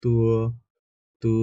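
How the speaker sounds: তু said as tu; tu with u glide. Shown as a demonstration. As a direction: say তু as t plus a drawn u. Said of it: "তয়ে জ ফলা দেন".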